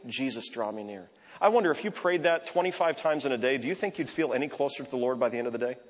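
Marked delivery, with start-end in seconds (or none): 0.1-1.1 soft, lower murmured plea; quiet breath prayer. Shown as a demonstration. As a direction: breathe it out in the traffic. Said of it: "Somebody was just unpleasant".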